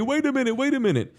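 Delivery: high-pitched imitation of a protesting manager; panicked manager. Fake-place manager calling from behind the customer, high imitated protest. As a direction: cut in high, like a manager chasing a complainer. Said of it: "I can hear the manager of the fake place".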